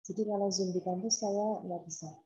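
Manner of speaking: usual speaking voice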